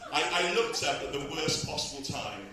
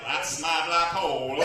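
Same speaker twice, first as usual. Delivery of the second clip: Stephen Hawking impression; 7 dB louder, slower than usual. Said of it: "impression of Stephen Hawkins".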